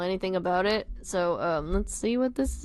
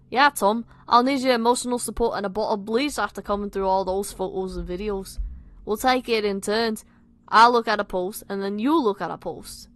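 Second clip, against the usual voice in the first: louder put-on Scouse; bad Liverpudlian accent; 5 dB louder than usual. Nick refusing his friends, bad Liverpudlian accent. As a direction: insist in a rough Liverpool accent. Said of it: "my shit Liverpudlian accent".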